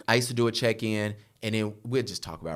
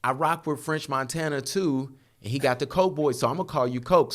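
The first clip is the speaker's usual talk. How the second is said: teasing barber; 6 semitones higher, 3 dB louder than usual. Retold or imitated. Barber at church naming the young man, hyped and teasing. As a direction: crow it out like you just thought of the perfect nickname. Barber giving him his nickname.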